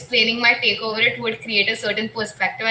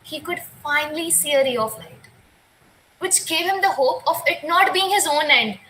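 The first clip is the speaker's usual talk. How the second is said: poem recitation; 4 semitones higher than usual. Acted.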